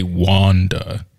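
Wanda drawn out as WAHN-duh, to rhyme with [name]; rhyming pronunciation. Shown as a demonstration. Co-host defending his rhyme, Wanda slowly as WAHN-duh. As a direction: stretch Wanda slowly with an "ah" vowel. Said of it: "if I said Wanda, then that wouldn't".

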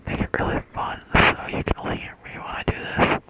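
close-mic whisper into the ear; right in ear. Real, not acted. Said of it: "whisper into it".

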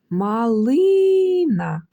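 slow calling tone, stress stretched on "ли" in "Малина". Shown as a demonstration. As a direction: hold the middle "ли" of "Малина" as if calling. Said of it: "треба покликати цю малину".

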